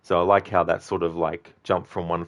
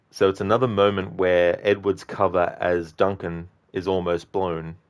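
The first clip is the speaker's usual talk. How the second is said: normal talking voice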